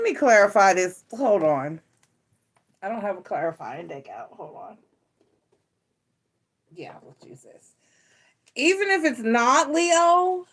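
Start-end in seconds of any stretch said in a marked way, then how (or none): 2.9-7.7 barely voiced muttering to herself; whispered aside. Real, not acted. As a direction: whisper it to yourself. Muttered aside while shuffling.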